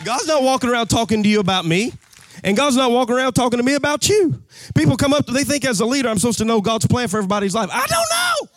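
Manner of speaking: full-force preaching. Acted.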